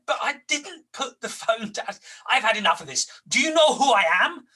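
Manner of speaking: fed up and standing his ground; indignant. Retold or imitated. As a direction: junior snapping back at caller. Young banker answers back indignantly.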